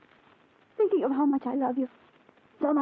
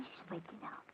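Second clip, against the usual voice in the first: hushed whisper at the bedside; 5 semitones lower, 20 dB quieter than usual. Acted. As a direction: whisper so as not to wake her.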